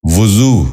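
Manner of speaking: وضو said as "vu-zoo", short u after v; correct pronunciation. Shown as a demonstration. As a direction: open وضو with "vu". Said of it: "درست تلفظ ہے".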